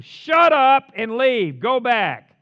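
loud, high bellowed order; exasperated leader shouting them down; angry shout. Acted. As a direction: yell it slowly at the crowd. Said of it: "and Steve told the people".

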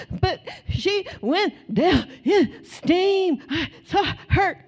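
imitating a little daughter; insistent little girl. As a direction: plead like a frightened toddler.

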